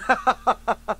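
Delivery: loud, high burst of laughter; mocking laugh. Friend laughs at the primer offer, mockingly.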